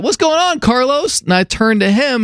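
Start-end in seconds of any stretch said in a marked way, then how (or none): 0.0-1.2 high-pitched imitated neighbor calling over; hammed-up curious holler; neighbor impression. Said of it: "He's like".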